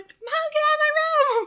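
high, loud bratty shriek; hammed-up screechy protest; mock screeching. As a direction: screech it like an annoyed teen. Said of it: "this inhuman screeching".

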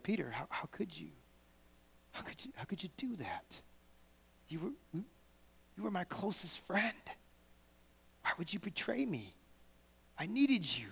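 soft, whispered reproach; hurt whisper. Retold or imitated. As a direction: plead gently in a whisper. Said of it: "Jesus' face was like".

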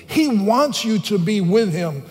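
fervent preaching. Acted. Preacher challenging churchgoers about being born again, fervent and loud. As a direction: press the point home from the pulpit.

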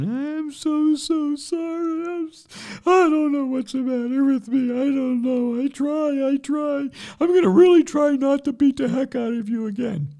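loud, high-pitched fake wailing; mock crying. Priest apologizes after breaking your leg, hammed-up crying. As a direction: bawl the apology in a hammed-up sob. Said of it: "this time I'm crying".

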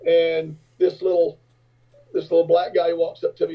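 normal talking voice

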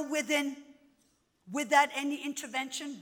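usual speaking voice

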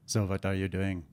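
put-on smooth-operator take on an Indian accent; Goomba-style Indian accent. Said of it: "she likes me to go Goomba style".

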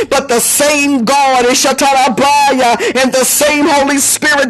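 fervent preacher's cadence; charged sermon delivery; preaching. Acted. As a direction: preach it with rising fire.

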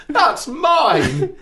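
shrill high indignant cry of a mother, laughing; mock mum outburst. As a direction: squeal it like mum catching the thief. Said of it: "so Mum went".

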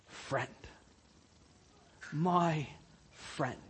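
tender. Pretend. Hush it like a tender promise. Christ's tender word imagined.

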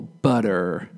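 "butter" as "budder", heavy R at the end; American strong R. Shown as a demonstration. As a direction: finish "butter" on a pronounced American R. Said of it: "So the R is very strong".

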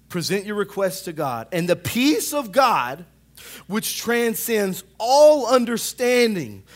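scripture read rising to a cry; scripture reading building to preaching. Acted. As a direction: build the verse from steady reading into full preaching. Reads Philippians aloud, building.

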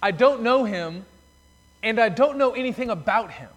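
emphatic denial. Retold or imitated. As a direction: push him away with every word. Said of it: "It's like he's saying".

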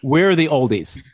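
loud, slow we're the oldies; slow, stressed we're; emphatic. Real, not acted. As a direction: drive the point home to Steve. Telling Steve they're old.

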